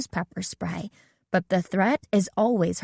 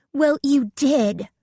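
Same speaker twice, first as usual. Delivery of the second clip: higher, louder and slow indignant reply; 7 semitones higher, 4 dB louder, slower than usual. A narrator's reading. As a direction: let her hear you're offended. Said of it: "Bess said indignantly".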